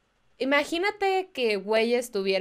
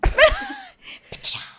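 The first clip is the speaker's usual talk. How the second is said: squealing burst of laughter; high shrieking laugh; 7 dB louder than usual. Real, not acted. Laughs at painful joke. (carcajada aguda)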